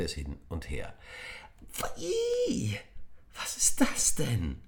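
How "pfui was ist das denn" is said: a high shriek of disgust, then a hushed, slow whisper; shrieking girl who fears it's real blood; startled disgust. A narrator's reading. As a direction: recoil from the fake blood. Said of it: "fragte Emi erschrocken".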